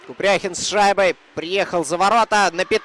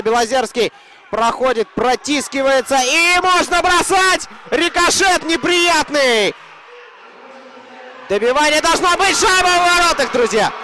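excited hockey goal call; 8 semitones higher, 3 dB louder than usual. Real, not acted. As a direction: yell the goal out to the fans. Commentator calls a goal.

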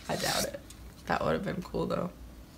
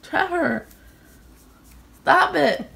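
loud, higher playful scolding; playful yelling; 9 semitones higher, 7 dB louder than usual. Real, not acted. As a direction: yell at him half laughing.

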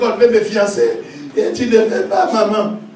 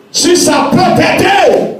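slow, drawn-out shout, much higher; 11 semitones higher, 9 dB louder, much slower than usual. Acted. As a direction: hurl it out slowly like a challenge. Repeated, second shouted.

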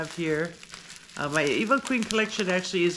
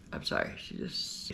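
quiet, teary apology, voice breaking; fighting back real tears; choked up; 9 dB quieter than usual. Real, not acted. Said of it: "sometimes I'm just emotional".

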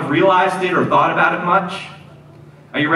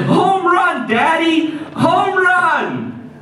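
put-on much higher, excited kid voice; little boy cheering; 9 semitones higher, much slower than usual. Pastor voicing the boy cheering to his dad, high excited kid voice. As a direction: squeal it like a four-year-old.

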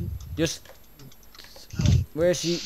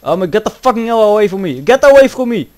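high, very loud frantic yelling; panicked shout; 6 semitones higher, 11 dB louder than usual. Real, not acted. Player ordering the pursuer away, panicked shout. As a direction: scream at them to back off.

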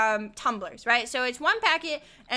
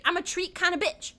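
ordinary talk, nothing marked

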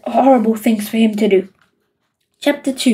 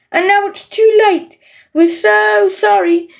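shriek; 7 semitones higher than usual. A narrator's reading. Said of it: "they would shriek".

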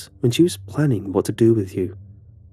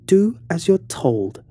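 slow, higher, cold order; cold command; 8 semitones higher, 3 dB louder, slower than usual. Acted. Alice turns on him, slow and cold with menace. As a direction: spell out each word as a threat. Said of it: "her smile gone".

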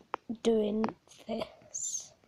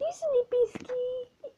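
high, slow cooing squeal; 12 semitones higher, 4 dB louder, slower than usual. Real, not acted. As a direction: coo at the hamster. Cooing to hamster Biscuit.

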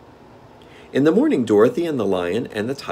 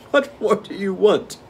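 gnome king; 13 semitones higher, 5 dB louder than usual. Acted. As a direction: relish the game like a spoiled monarch.

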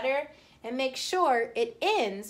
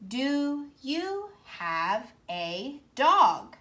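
slow reading; 3 semitones higher, much slower than usual. Shown as a demonstration. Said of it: "I'm asking a question".

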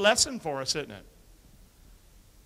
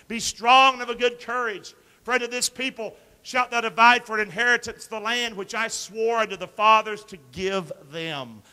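loud, ringing Bible read; forceful scripture reading; 5 semitones higher, 7 dB louder than usual. Acted. Pastor reading Joshua to the church, loud. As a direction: declare it like a command.

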